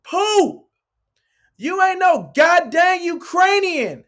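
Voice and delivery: high, loud and slow mock taunt; teasing shout. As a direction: heckle him across the court. Cousins teasing shout across court.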